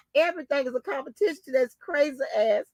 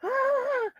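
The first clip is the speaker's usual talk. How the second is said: high squeal; 13 semitones higher, 4 dB quieter than usual. Real, not acted. Laughing fit.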